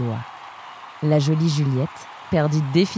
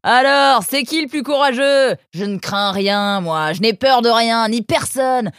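high, loud swaggering braggart; haranguing the crowd; 7 semitones higher, 6 dB louder than usual. Acted. Fifth contestant boasting to the crowd, loud bravado. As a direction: brag to the crowd like a madman. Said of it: "à haranguer la foule".

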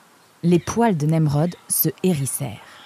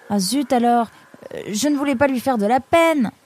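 dismayed; 7 semitones higher than usual. Acted. Nemrod regrette d'avoir vexé l'oiseau.

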